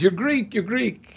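imitating an insistent Greek driver, pitched high; retold excited insistence, voice jumping up. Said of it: "he says".

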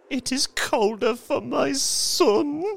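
put-on very high, tearful old woman; weeping. A narrator's reading. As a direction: wail it through tears as a grieving mother. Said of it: "and wept afresh".

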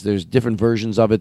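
ordinary talk

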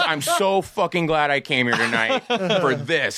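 starstruck gushing. Retold or imitated. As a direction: gush like an overwhelmed fan. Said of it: "the most starstruck I had ever seen".

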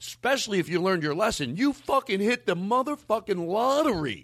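high, incredulous rant in his head; mock disbelief at her stupidity; exasperated inner rant.

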